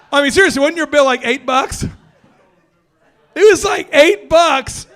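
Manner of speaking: high and loud, hammering eight bucks; outraged amusement at the tiny order; loud laughing exclaim. Real, not acted. Reminding D about the eight dollar bill, shouting.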